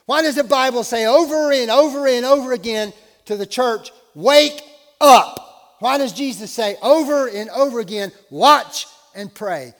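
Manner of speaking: shouted, high-pitched sermon plea; full-force preaching. Real, not acted. Preacher urging the church to wake up, full-force preaching. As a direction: cry out to rouse a sleeping church.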